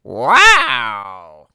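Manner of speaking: loud, thrilled exclamation; cat Alfonso thrilled at the news; excited wow. A narrator's reading. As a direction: burst out with delight. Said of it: "Alfonso thought".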